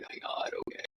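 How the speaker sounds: breathy half-voiced whisper of dismay; whispered aside. Patient whispering her dismay at the IV news. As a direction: whisper it under your breath, stunned.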